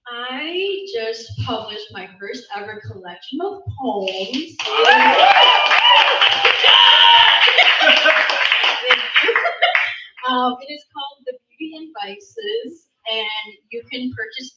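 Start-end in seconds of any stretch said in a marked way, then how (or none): 4.6-10.2 loud, high-pitched giggle; high squealing laugh. Real, not acted. Nervous laugh after announcing poems.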